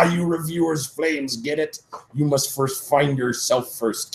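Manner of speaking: put-on high, slow, old wizard voice; hammed-up high wizard drawl; Dumbledore. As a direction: play a strange old wizard, slow and lofty. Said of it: "Dumbledore said".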